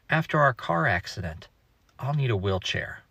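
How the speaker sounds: put-on soft, quiet little-kid voice, lightly done; innocent child voice. A mom quoting her four-year-old in a soft, innocent voice. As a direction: quote the boy sweetly and softly. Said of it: "in his perfectly innocent four-year-old voice".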